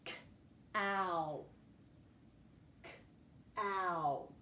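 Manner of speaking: "K-ow" split, short k and drawn-out ow; sounding out. Shown as a demonstration. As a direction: pause after the k in "K-ow", hold the ow. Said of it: "we hear it at the end".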